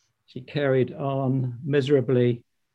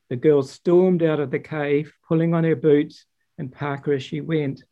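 ordinary delivery

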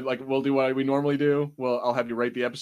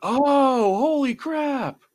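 high, slow exclaim of real surprise; a stunned higher-pitched holy crap; sudden realization; 13 semitones higher, 5 dB louder, much slower than usual. Real, not acted. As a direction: it just clicked, blurt it out. Realizing who the actor is.

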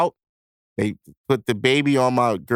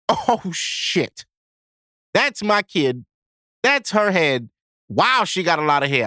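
put-on high, excited disbelief at first sight of his child; amazed excitement; 7 semitones higher than usual. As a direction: let it burst out in awe. Seeing his daughter's head appear.

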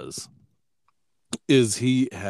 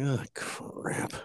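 whispered mock dog thought; dog's inner voice; 4 semitones higher, 11 dB quieter than usual. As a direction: breathe it out like a fed-up dog. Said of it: "this dog is like".

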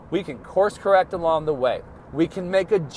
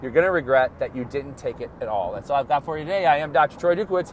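ordinary talk

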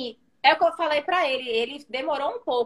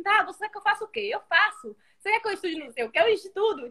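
put-on 1 eager boyfriend; 7 semitones higher than usual.

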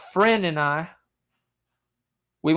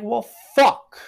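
higher, louder put-on groan of defeat; a hammed-up deflated curse; mock dismay; 9 semitones higher, 5 dB louder than usual. Friends staring at each other by the locked car, mock dismay.

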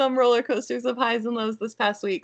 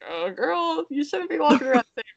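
high-pitched, playful telling-off; mock scolding; 8 semitones higher than usual. Mom tells toddler off for swearing, high mock scolding. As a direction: chide a toddler without real anger.

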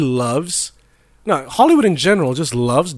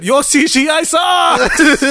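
high, drawn-out mock wail; mock outcry; 10 semitones higher, 3 dB louder, much slower than usual. Mocking a movie's effects to friends, high mock wail. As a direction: stretch it out high, breaking into laughter.

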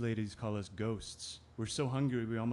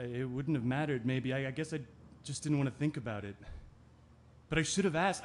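ordinary talk, nothing marked

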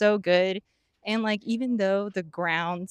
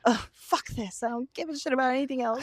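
higher-pitched mock despair; fed-up upset; 5 semitones higher, faster than usual. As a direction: retell it as an exasperated, upset outburst. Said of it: "I was so depressed and upset".

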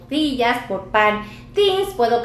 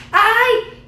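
startled cry; 10 semitones higher, 5 dB louder than usual. Real, not acted. Camera battery suddenly dies.

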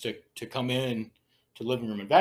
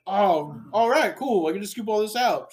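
higher-pitched mock stepsister, breezily agreeing; voiced stepsister; 8 semitones higher, 4 dB louder than usual. Narrator mimics stepsister going along, pitched up. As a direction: lift the pitch for a game stepsister.

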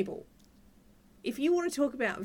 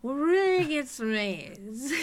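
put-on drunk Giles; 3 semitones higher, 4 dB louder, slower than usual. Cohost imitating a tipsy TV character's grumble, drunken slur. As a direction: slur it slowly, words sliding together. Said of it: "I really enjoyed drunk Giles".

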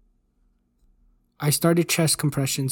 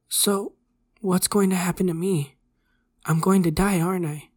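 put-on higher-pitched, fearful boy; frightened pleading; 5 semitones higher than usual. A narrator's reading. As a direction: ask as a boy afraid he is dying. Dying boy asks his fate.

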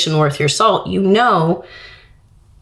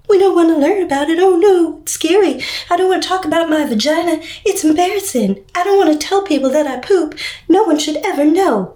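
much higher, shrill hammed-up panic; mocking prudish parent; 9 semitones higher, faster than usual. Parent opting out of health class, hammed-up mock horror. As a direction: sarcastically play a scandalised parent. Mocking people afraid of bodies.

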